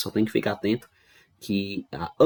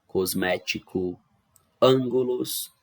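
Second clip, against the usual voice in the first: usual speaking voice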